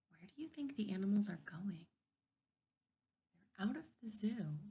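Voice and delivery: fast hushed whisper; teacher models whispering predictions. Shown as a demonstration. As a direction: whisper it like a secret to the class. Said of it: "Whisper in your hand".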